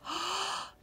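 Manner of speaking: sharp gasp of mock surprise; a quick hushed storytime gasp; surprised gasp. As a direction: gasp as if you found it empty. Surprised peek into empty shell.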